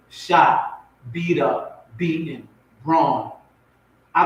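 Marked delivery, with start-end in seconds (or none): none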